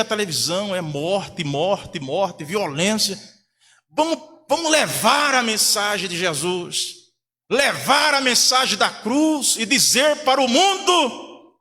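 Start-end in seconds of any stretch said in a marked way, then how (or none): 3.9-11.6 raised, drawn-out exhortation; rally the church with rising force; impassioned preacher's call; rousing preaching. Real, not acted.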